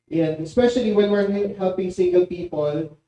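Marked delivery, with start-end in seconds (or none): none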